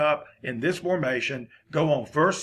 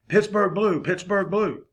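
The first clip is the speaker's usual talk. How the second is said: mock play call; 6 semitones higher, 3 dB louder, slower than usual. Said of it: "I'm just making these up".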